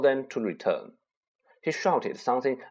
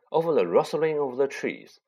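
ordinary delivery, nothing marked

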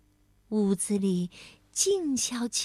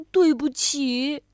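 slow, drawn-out sorry; heartfelt apology; 3 dB louder, slower than usual. A narrator's reading. Bengbeng hugs best friend, apologising sincerely.

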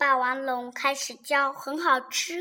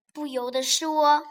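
ordinary delivery

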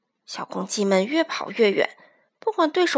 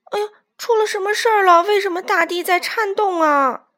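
high, startled questioning, quick at first; alarmed; 8 semitones higher, 4 dB louder than usual. A narrator's reading. Chick feeling the ground shake, alarmed. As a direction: blurt out the alarm.

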